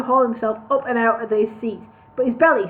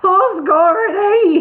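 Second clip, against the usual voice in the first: chuckling brewer; 8 semitones higher, 7 dB louder, much slower than usual. Acted. Brewer laughing in story.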